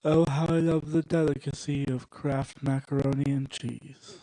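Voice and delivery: put-on British gentleman savouring food; hammed-up British connoisseur; British accent. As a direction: rhapsodize in a mock British accent. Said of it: "some British guy's just like".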